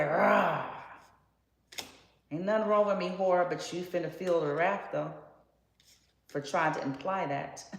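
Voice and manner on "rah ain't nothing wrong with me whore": loud mock snarl; a touch of a loud, mock-fierce comeback; defiant retort. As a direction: bark it back like a challenge. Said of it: "and say".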